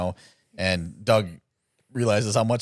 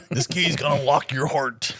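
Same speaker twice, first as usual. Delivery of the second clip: wrestler promo; 5 semitones higher than usual. Pretend.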